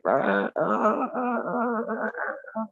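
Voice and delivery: creaky squawk like something that needs WD-40; strangled bird squawk imitation. Shown as a demonstration. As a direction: imitate a hurt bird being strangled, drawn out. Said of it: "I'm not doing that voice again".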